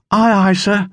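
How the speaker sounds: put-on small boy calling back, aye aye; small boy's reply. A narrator's reading. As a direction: answer like a keen young sailor. Boy answers the order.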